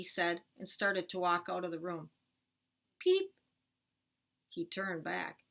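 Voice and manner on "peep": sudden high, louder little peep; baby bird peep. Acted. Hidden bird peeping as dad leaves the room, bird peep. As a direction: chirp like a tiny bird.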